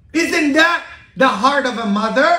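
loud, high rhetorical question; preaching climax. Acted. Preacher asking the congregation, loud and high. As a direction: lift the whole church with it.

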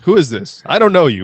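high, loud "who is this, I don't know you"; mock indignant shout. Answering a charity caller, mock indignant loud shout. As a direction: bark it like an offended stranger.

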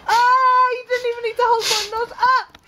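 high, loud disgusted outcry; disgusted yelling. Real, not acted. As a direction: yell out in horror. Cameraman reacting in disgust.

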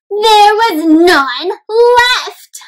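slow, high mock-crying wail; mock sad. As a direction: drag it out like a sad cry. Teacher reading sad story moment.

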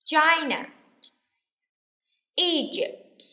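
high, clearly projected single words; teacher's repeat-after-me voice; model for repetition. Shown as a demonstration. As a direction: drill each word with a firm fall. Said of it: "One more time".

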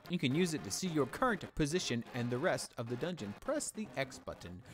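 ordinary delivery

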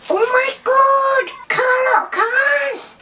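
high, slow, drawn-out delight; squeaky excitement. Pretend. Child character finding toy cars, squealing with excitement. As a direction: squeal it like a thrilled little kid.

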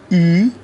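او as a loud, long German ü; drawn-out, rounded u-umlaut. Shown as a demonstration. Teacher demonstrates u-umlaut alone, او loud, long and rounded. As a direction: round the lips and stretch او into ü.

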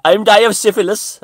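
squeaky, very high and loud Welsh voice; mock Welsh druid. Vlogger voicing a cosplay Welsh druid introducing himself, high Welsh accent. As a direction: announce yourself as a silly Welsh druid. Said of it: "he has a name like".

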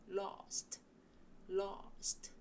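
"lost" with a wide-open mouth aw vowel. Shown as a demonstration. Teacher showing learners the open vowel in lost. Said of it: "입이 큰 어 소리예요".

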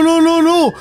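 usual speaking voice

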